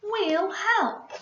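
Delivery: loud, high, eager mouse; excited Spike. Acted. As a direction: cheer him on eagerly. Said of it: "said Spike".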